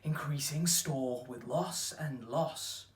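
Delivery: slow, loud declaimed sonnet; poetry recital. Acted. Actor reciting a sonnet on time's ruin, declaimed. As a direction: declaim it in measured, weighty phrases.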